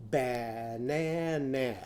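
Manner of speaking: "Banana" slowly with every vowel as a short a. Shown as a demonstration. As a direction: stretch "Banana" into ba-na-na with flat a. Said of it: "You say that".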